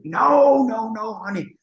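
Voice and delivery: high imitated "no, no, honey"; breezy refusal. Singer refusing to come listen, breezy refusal. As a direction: wave him off in a high mimicking voice. Retold singer brushes him off.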